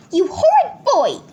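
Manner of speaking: high, loud scolding; angry mum yell. A narrator's reading. Mum scolds Peter over the china, high yell. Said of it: "yelled Mum".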